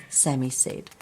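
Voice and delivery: usual speaking voice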